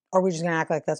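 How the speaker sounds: ordinary delivery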